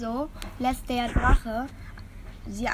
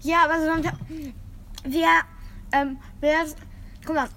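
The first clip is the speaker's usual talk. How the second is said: chewing with mouth open; smacking while eating; 5 semitones higher than usual. Real, not acted. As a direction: talk while chewing noisily. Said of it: "[name], schmatz nicht".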